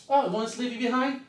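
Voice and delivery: high, loud mock cheerfulness; sarcastic brush-off. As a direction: toss him off with a sarcastic farewell. Friends mockingly abandon him.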